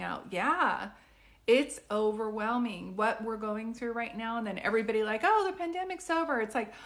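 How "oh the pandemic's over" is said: mock cheery quote. Mocking what everybody says.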